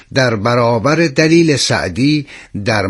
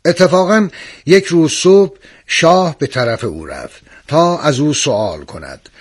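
plain reading voice

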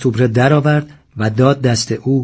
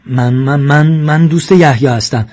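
higher-pitched stutter on the opening word; flustered stammering; 3 semitones higher, 5 dB louder than usual. A narrator's reading. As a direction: trip over the start, then push it out louder. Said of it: "ساسان به تته پته افتاد". (با لکنت و دستپاچگی)

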